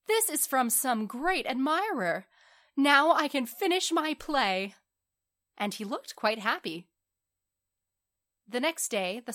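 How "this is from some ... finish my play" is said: high, loud excited exclamation; delighted cry. A narrator's reading. Young writer with a gift from an admirer, elated. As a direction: exclaim it joyfully, pitched up. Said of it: "he cried".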